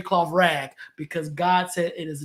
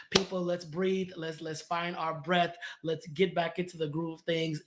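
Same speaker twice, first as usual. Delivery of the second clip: softer, soothing call to breathe; calming invitation; 8 dB quieter than usual. Acted. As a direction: ease them gently into breathing. Calming his viewers, voiced message.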